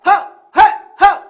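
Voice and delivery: short hailing bursts; calling out. Shown as a demonstration. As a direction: punch out short separate calls. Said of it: "It can also go like this".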